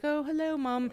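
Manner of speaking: lightly put-on British lilt for a three-year-old; mock British accent. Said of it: "she had this British accent".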